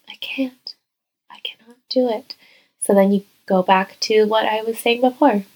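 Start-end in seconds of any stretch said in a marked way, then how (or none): 0.1-2.4 breathy half-whispered self-doubt; small defeated whisper of giving up; hushed inner doubt. Retold or imitated. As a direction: let the scared inner voice barely say it.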